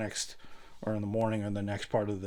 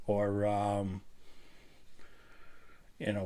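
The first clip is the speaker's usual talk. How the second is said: ordinary talk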